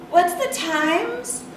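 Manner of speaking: high, drawn-out puzzled student; clueless student. A narrator's reading. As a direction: ask it slowly, high and blankly. Narrator voices a clueless student.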